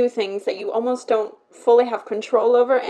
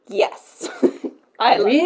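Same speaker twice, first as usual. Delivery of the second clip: mock self-love; 4 semitones higher, slower than usual. Imitating people praising themselves in the mirror, mock adoring. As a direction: tease confident people admiring themselves. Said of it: "they're like".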